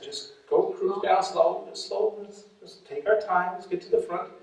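soft and slow, easing the driver along; quiet slow coaxing. Retold or imitated. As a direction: hush him down, slow and gentle. Calming the nervous driver, quiet.

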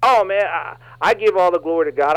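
ordinary delivery

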